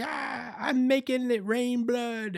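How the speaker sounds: very high, slow, gleeful voice; bloodthirsty frenzy. Retold or imitated. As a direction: revel like a crazed killer. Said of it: "she's like".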